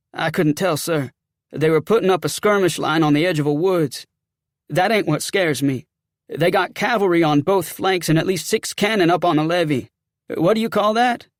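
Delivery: agitated Southern soldier; rattled sergeant. Acted.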